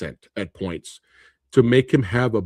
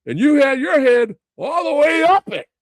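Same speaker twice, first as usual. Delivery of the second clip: high, loud Pacino yell; hammed-up Pacino bellow, slow and loud; Al Pacino shout; 11 semitones higher, 4 dB louder, slower than usual. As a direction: belt it out like Pacino in Heat. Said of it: "the memorable line from Al Pacino".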